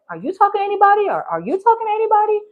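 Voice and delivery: high, quick mock-nosy questioning; probing question. Friends feeling each other out about dating, high quick probing. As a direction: imitate a fast, high-pitched nosy check.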